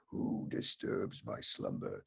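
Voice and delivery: deep, slow whispered rumble of a stone cave; grinding stone statue. Retold or imitated. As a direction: rumble it like a giant stone head waking. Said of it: "That's Frank".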